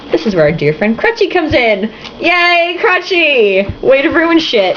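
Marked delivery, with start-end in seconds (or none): 2.2-4.8 mock cheer.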